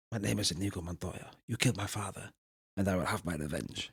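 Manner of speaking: quiet, low, dramatic recital; hushed film quote. Retold or imitated. Retelling a film to a friend, quiet dramatic quote. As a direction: murmur it like a sworn vow. Quoting film line again.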